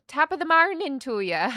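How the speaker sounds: high, loud Irish lilt; Irish accent. Retold or imitated. Student auditioning for the director in the hallway, Irish accent. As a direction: put on a hammed-up Irish accent. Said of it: "I could do an Irish accent".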